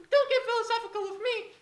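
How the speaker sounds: high, loud puppet voice. Acted. Puppet scolds the other puppet, high squeaky voice.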